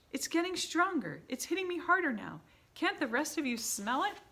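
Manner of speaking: high, loud, urgent; urgent sniffing witch. A narrator's reading. Mildred keeping the witches still, high and urgent. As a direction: keep them frozen while she sniffs.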